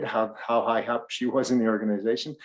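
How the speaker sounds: ordinary talk, nothing marked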